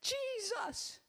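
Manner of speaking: high, drawn-out cry for rescue; retold desperate yell. Preacher reliving her cowering in the shower, desperate yell. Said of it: "and I yell".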